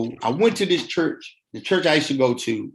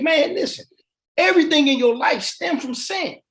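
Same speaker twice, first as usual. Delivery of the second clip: higher and ringing, like a pulpit; preachy urging; 10 semitones higher than usual. Retold or imitated. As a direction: drive it home like a preacher. Counselor preaching hard at him.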